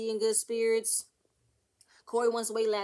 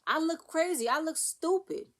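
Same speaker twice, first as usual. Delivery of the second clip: higher and louder, hurt and indignant; a retold wife's mock outburst, pitched up and louder; upset outcry; 6 semitones higher, 3 dB louder than usual. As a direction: cry out your humiliation to him. Wife confronting cheating husband.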